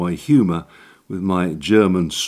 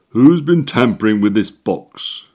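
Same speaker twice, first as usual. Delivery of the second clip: a stern official, much louder; stern demand; 3 semitones higher, 7 dB louder than usual. Acted. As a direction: demand an answer from the culprits, loud. Angry electrician demands answers.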